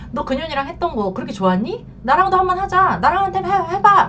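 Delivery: acting out a wounded wife, rising to high and loud; high, pushy mock confrontation; harsh retold demand. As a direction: imitate an angry wife goading her husband, pitch climbing. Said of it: "말이 또 곱게 안 나간 거야".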